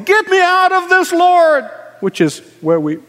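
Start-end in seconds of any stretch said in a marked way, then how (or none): 0.0-1.7 loud, high cry to God; desperate cry. Retold or imitated. Preacher voicing a believer in trouble crying out loud. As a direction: shout it up to heaven, begging. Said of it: "How did he go from".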